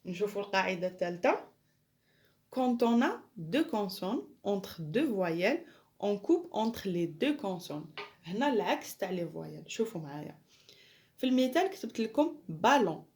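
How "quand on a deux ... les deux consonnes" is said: slow, deliberate French read-out; slow dictation. Shown as a demonstration. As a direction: spell it out slowly for pupils to copy. Teacher dictates French rule.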